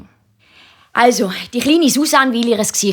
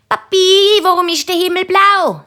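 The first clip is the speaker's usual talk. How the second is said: hohe, laut rufende Kinderstimme; löchere den Papi mit hoher Kinderstimme; fragendes Kind; 11 semitones higher, 4 dB louder than usual. A narrator's reading. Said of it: "stellt, äh, Nina und der Christoph ihrem Papi ständig Fragen". (hochi Chinderstimm, fröglet)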